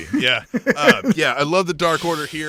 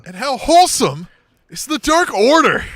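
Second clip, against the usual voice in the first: gushing; 10 semitones higher, 7 dB louder than usual. Real, not acted.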